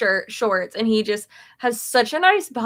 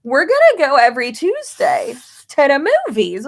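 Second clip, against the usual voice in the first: excited plan; 5 semitones higher, 7 dB louder than usual. Retold or imitated. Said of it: "It's like".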